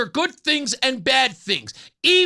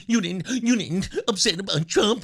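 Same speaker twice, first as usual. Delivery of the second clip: mock whine broken by laughter; laughing mock whine; 3 semitones lower than usual. Mocking grown men who whine, laughing. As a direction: giggle through a mocking whine. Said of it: "people whine like children".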